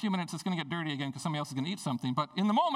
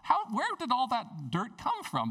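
high, slow, stunned disbelief; drawn-out bewildered question; shocked thought; 8 semitones higher than usual. Retold or imitated. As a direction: gasp it out at the filthy water. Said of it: "I'm thinking".

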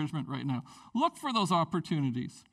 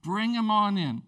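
louder, slow and emphatic; emphatic welcome; 3 semitones higher, 6 dB louder than usual. Real, not acted. Pastor urging church to welcome latecomers, emphatic. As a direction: spell out each word to invite.

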